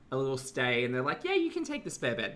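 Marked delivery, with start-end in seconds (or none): 1.2-2.3 high, friendly host imitation; lightly voiced welcoming relative.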